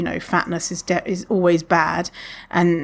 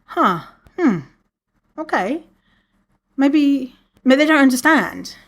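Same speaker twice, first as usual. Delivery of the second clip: hesitant; 6 semitones higher than usual. Retold or imitated. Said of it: "And I was like".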